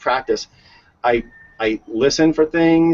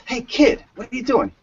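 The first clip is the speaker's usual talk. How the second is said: higher, lightly voiced nosy neighbor; neighbor calling out; 10 semitones higher than usual. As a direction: ask the hidden kid what he's up to. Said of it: "He goes".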